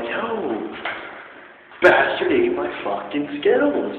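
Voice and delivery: high, loud, slow indignant yell; outraged, half laughing; retelling it in high, slow outrage; mock outrage. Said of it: "I'm like".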